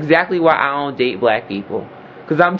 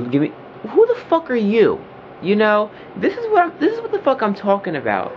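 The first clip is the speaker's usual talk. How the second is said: high, heated tirade; real outburst, voice up high; angry rant; 4 semitones higher than usual. Real, not acted. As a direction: let it all out, pitch climbing. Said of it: "I don't mean to get loud".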